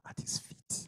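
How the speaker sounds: hushed reverent whisper. Acted. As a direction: breathe the answer. Preacher hushes answer.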